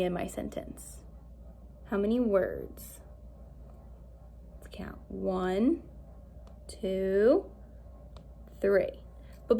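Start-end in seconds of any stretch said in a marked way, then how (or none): 4.5-5.0 whispered aside; soft whisper to the class. Real, not acted.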